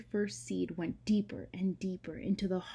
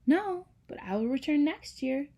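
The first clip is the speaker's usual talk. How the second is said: high bright bird voice, firm no; gently refuse, promise to return; Joy telling the mountain she will come back; Joy answering; 7 semitones higher, 5 dB louder than usual. Acted. Joy voiced in read story.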